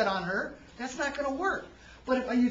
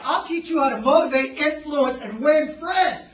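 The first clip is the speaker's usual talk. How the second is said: loud, hard-sell stage delivery; forceful power pitch; 3 semitones higher, 10 dB louder than usual. Shown as a demonstration. Speaker demonstrating a pitch to the workshop, loud and forceful. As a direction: belt it out to the whole room. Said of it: "how about this body language".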